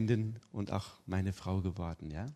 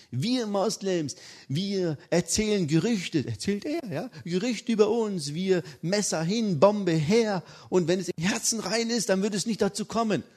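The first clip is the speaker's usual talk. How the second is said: father explaining to his son, earnest and raised; 9 semitones higher, 5 dB louder than usual. Retold or imitated. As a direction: speak like a sincere old father.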